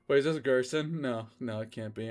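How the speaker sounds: normal talking voice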